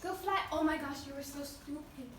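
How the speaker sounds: usual speaking voice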